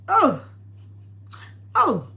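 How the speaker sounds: short, loud falling "oh!"; pained cries. Real, not acted. Cook with onion in the eyes, pained cries. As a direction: cry out at each sting.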